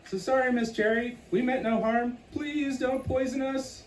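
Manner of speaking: high, trembling plea; frightened pleading. Acted. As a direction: beg for mercy, high and small. Critters begging the scary millipede.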